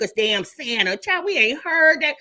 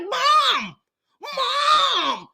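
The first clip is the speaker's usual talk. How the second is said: put-on much higher whining cry of a teen; whiny daughter; 12 semitones higher than usual. Olivia begging her mother to stop, high whiny mock cry. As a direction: plead like an embarrassed kid. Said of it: "Olivia's like".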